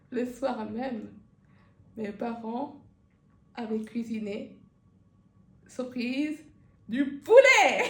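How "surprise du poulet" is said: lancé fort, plus haut, en riant presque; chute éclatante. Real, not acted. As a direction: balance la chute avec éclat. Chute comique, surprise du poulet. (annonce du poulet criée de rire)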